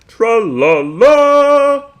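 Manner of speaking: high, loud, slow sing-song cartoon hero; Captain Underpants impression. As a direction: belt it out like a goofy superhero. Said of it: "Did I do a good imitation of Tra-, Captain Underpants?".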